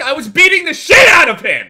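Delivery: high, loud outburst of disbelief; outraged yell. Real, not acted. Gamer protesting an unfair revenge, outraged yell. Outraged at losing a game round.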